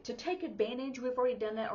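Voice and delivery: normal talking voice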